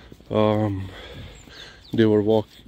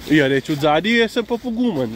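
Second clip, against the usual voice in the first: higher, quick little-dog voice; a lightly voiced small dog bragging, quick and higher; small dog's voice; 6 semitones higher, faster than usual. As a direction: voice the brave little dog talking fast. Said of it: "Small doggo is brave from behind".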